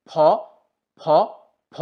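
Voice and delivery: ফ with lips pressed and a puff of air; F sound demo. Shown as a demonstration. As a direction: push air out through closed lips on ফ. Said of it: "ফি যখন আমি উচ্চারণ করব, তখন এই দুটা lip একত্রিত হবে". (ঠোঁট চেপে বাতাস ছেড়ে ফ উচ্চারণ)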